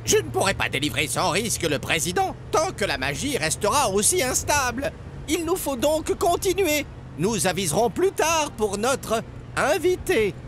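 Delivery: high, grave magician voice; magician character. A narrator's reading. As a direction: explain the plan solemnly. Magician speaking.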